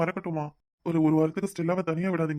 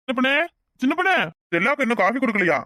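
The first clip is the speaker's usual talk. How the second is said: calling out; 6 semitones higher than usual. Acted. Husband calls wife from another room.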